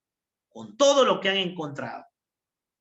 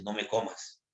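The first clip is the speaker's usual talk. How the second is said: soft, lower little begging voice; quiet plea; 8 semitones lower, 8 dB quieter than usual. Acted. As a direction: plead softly, almost under breath. Bacteria begs not eaten. (súplica en voz baja)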